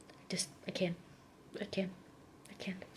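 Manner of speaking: a defeated murmur dying away; fading out. Real, not acted. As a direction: trail off, overwhelmed. Overwhelmed, trailing off.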